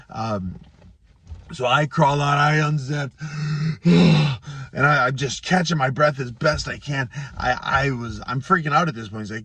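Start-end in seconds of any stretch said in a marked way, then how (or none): 3.2-4.7 a heavy, loud sigh of relief; exhausted gasping sigh. Retold or imitated. Loud heavy sigh after crawling out of the sauna. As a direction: blow out hard like you just escaped the heat.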